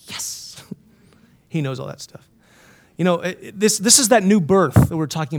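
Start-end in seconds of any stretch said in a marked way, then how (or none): 0.1-0.7 tiny whispered confirmation; hushed aside; whispered yes. Real, not acted. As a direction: whisper it to yourself, pleased to be right.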